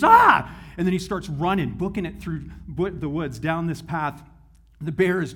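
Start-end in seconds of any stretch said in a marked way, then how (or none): 0.0-0.5 a huge, high shriek of terror; piercing panic scream, much higher and louder; terrified scream. Retold or imitated. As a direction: scream as the bear rears up. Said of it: "and he screams".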